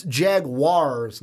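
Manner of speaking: Jaguars spelled out slowly as Jag-wars; drawn-out careful Jaguars; slow correct pronunciation. Shown as a demonstration. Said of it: "I kept saying Jaguars".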